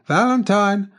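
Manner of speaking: high, loud call of a name. A narrator's reading. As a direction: call to someone across the room. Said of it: "Someone was calling".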